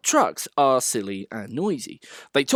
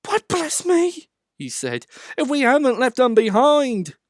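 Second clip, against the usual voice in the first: startled; 12 semitones higher, 6 dB louder than usual. A narrator's reading. Thomas realises coaches are gone.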